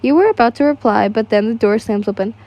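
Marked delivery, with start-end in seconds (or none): none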